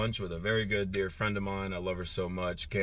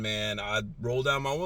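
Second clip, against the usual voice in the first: ordinary talk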